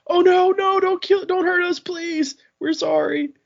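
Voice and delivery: comic shrill plea of frightened townsfolk; terrified begging. Retold or imitated. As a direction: cower and plead for your life. Townsfolk fear the sand master.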